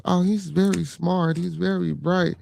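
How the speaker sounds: high, slow mock teacher praise; lightly mocking teacher voice; imitating teachers. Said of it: "all his teachers".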